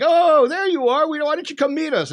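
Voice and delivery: loud opening oh, then high chiding question; exclaimed greeting. Retold or imitated. Retold friends greeting him on return.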